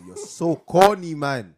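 drawn-out shout of disgust; loud slow yell. Real, not acted. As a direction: belt it out slowly in contempt. Ranting about Americans.

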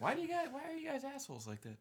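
squeaky, quiet complaint; high-pitched protest. Real, not acted. Friend protesting at laughing buddies, high soft complaint.

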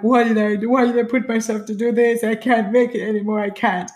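mildly acted tearful panic; retold crying. Runner near the last three K, retold crying. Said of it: "And I was crying".

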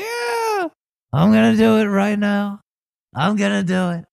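very high, excited crowd cheer; cheering at a game. Acted. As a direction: shout back like an eager fan. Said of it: "Everyone's like".

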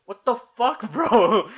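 high, loud indignant outburst with laughter; mock startled anger. Sleeper waking to an intruder, mock indignant yelp. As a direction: pretend to wake up outraged. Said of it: "wake up and be like".

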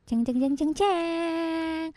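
mock horror piano by mouth; imitated scary soundtrack; voiced horror-film sting. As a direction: sing out a suspense jingle, last note held high. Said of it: "bunyi piano yang kayak di film-film horor".

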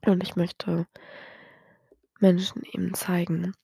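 usual speaking voice

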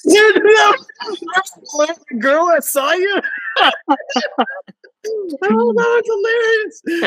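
high, laughing through the words; can't stop giggling at the memory. Real, not acted. Remembering his friend conducting in the car, laughing high.

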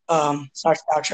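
rough, hoarse voice from asthma; hoarse and worn, voice going. Real, not acted. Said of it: "my voice is bad today".

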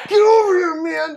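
high shouted call, hammed up; mock yell. Buddy yelling across the yard, a hammed-up mock shout. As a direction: yell him over like it's urgent.

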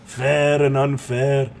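hammed-up movie character impression; mock-earnest character monologue in a put-on voice; Fat Bastard. Telling friends of an overweight childhood, Fat Bastard impression. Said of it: "I'll do fat bastard".

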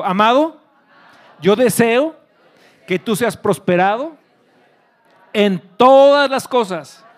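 slow, high, very loud proclamation; loud declaration. Real, not acted. Preacher leading the congregation to repeat, loud declaration. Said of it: "repite conmigo fuerte".

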